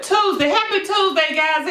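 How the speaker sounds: bright high happy Tuesday; cheery greeting. Real, not acted. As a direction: sing out the greeting. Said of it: "cheery greeting".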